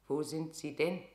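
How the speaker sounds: usual speaking voice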